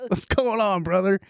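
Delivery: high, hyped bro doctor; frat bro. Retold or imitated. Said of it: "imagine if he started the call like this".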